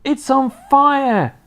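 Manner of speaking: high, drawn-out cry of alarm; Grandma raising the alarm at high pitch; alarmed yell. A narrator's reading. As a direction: yell it out loud, stretching the words. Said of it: "Grandma yelled".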